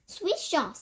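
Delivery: high cry echo; cried back. A narrator's reading. Witches crying back in surprise, high. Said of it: "they cried".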